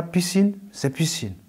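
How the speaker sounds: "piscine" said as in French, teacher voicing it as the Bambara form; "piscine" pronounced carefully, presented as the Bambara word; Bambara word demo. Shown as a demonstration. Teacher demonstrating "piscine" in Bambara for listeners. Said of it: "Piscine, en bambara".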